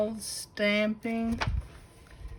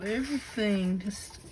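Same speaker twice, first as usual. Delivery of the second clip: normal talking voice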